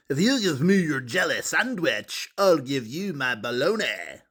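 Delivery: lightly voiced kid making a sly deal; scheming kid. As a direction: wheedle the sandwich out of him. Truman voiced, proposing a trade.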